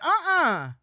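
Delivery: high, loud mimicked "uh-uh"; friend's instant objection. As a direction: object sharply, imitating a shocked friend. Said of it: "she immediately is like".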